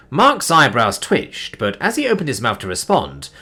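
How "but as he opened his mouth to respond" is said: plain reading voice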